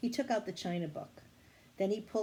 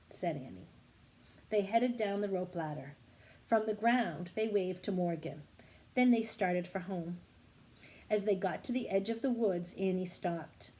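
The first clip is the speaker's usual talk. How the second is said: plain reading voice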